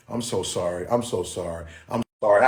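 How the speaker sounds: normal talking voice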